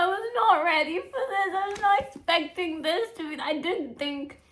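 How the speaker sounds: high, loud, breaking voice; overwhelmed almost crying; teary shock. Real, not acted. Reader overwhelmed by the twist, high and teary.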